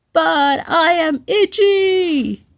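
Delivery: high, slow, pleading whine; whiny itchy dinosaur. Acted. Itchy dinosaur pleads with his stern friend, high and whiny. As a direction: beg to be allowed to scratch.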